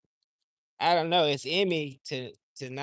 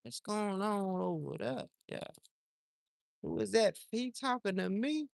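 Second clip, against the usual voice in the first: snake voice; 5 semitones higher than usual. Pretend. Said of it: "Be like".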